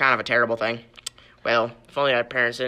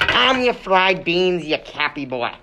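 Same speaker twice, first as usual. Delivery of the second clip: scolding; 5 semitones higher, 7 dB louder than usual. Acted. Host scolds the quarrelling guest.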